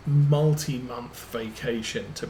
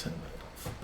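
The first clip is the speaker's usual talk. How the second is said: whispered "turn that off", much softer, no voice; a real whisper while fumbling with the car; 13 dB quieter than usual. Real, not acted. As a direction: breathe "turn that off" almost silently. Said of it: "Let's turn that off".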